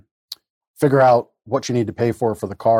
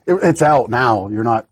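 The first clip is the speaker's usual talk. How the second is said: ordinary talk, nothing marked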